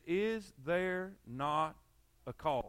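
slow, low, deliberate question; slow and weighty. Retold or imitated. Preacher quoting David to his brothers, slow and solemn. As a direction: let each word land heavy.

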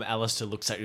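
normal talking voice

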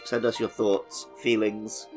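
usual speaking voice

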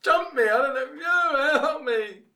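high, loud hammed-up wail; mock-distressed plea to bystanders; cry for help. [name] to the marshal after being jumped, high loud wail. Roleplays victim calling for help.

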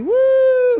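high, loud whoop of a fan whose team just won; mock fan cheer. Pastor acting out a fan after a win, high loud whoop.

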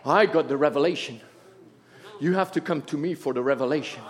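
mock their puffed-up claims under your breath; low quick scornful parody of a boaster; mocking self-important teacher. Mocking proud self-made prophets.

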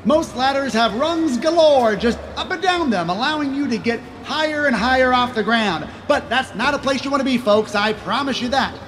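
high-pitched carnival barker pitch; snake oil seller. Acted. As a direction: hawk it to the crowd. Carnival pitchman selling ladder.

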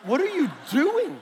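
high, loud, incredulous question; exasperated parent. Retold or imitated. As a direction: demand it like a baffled parent. Parent catches daughter eating at night.

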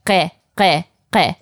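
Ghe repeated with a throaty voiced gh; guttural gh drill. Shown as a demonstration. Teacher drilling the throat sound for the learner. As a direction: rasp the gh of Ghe at the back of the throat. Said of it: "keep practicing this every day".

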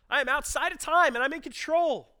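high, loud proclamation; loud, high voice of God; commanding divine voice. Acted. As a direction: declare it with full authority. Voicing God's answer.

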